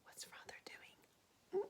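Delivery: soft unvoiced whisper. Real, not acted. Teacher whispering a question to the class. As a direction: whisper it like a secret.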